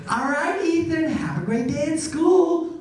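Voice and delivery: high, slow sing-song send-off; see your child off to school; mock parent voice; doting parent. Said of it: "ask their parents not to drop them off".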